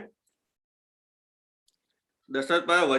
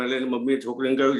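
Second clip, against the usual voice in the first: usual speaking voice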